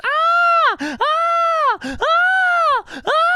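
loud high-pitched repeated screams; hammed-up shrieking; Asta-style screaming. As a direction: scream in bursts like an over-the-top anime hero. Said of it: "I'm talking about Asta level".

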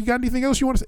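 retold challenge with the voice way up; cussing him out. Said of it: "I started cussing him out".

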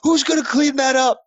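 put-on loud, high-pitched drunken yell; mock an obnoxious drunk hollering; hammed-up squawking complaint; drunk bro.

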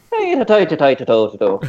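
sing-song, high start, hammed-up British goodbye; mock British ta-ta. Waving off the cruise stops in mock British sing-song. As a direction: wave it off like a caricature Brit.

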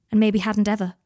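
plain reading voice

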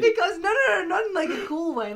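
high, loud, laughing denial; panicked laughing defense; flustered protest. Real, not acted. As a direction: backpedal fast and frantic. Defending herself amid laughter.

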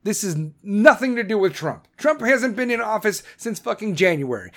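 ordinary delivery

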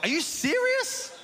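squeaky high incredulous squeal; a high-pitched, slowed-down mock outrage; shocked disbelief. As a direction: let your voice jump up in mock shock. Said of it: "On the inside, I was like".

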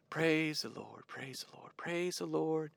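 breathy whispered praise the Lord. Retold or imitated. Worshipper sitting silently, praising in a whisper. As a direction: whisper it under your breath like a silent prayer. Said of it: "this mental exclamation point".

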